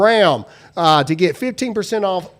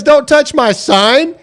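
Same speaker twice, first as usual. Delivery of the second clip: imitating the protester; 6 semitones higher, 4 dB louder, slower than usual. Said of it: "Listen to the way he says".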